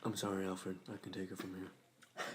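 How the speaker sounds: low, flat monotone robot-like voice; deadpan superhero with no expression; Cyborg impression. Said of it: "my cyborg impression".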